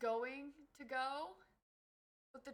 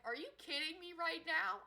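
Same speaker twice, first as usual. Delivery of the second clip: exasperated; 7 semitones higher, 4 dB louder than usual. Retold or imitated. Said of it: "I'm like".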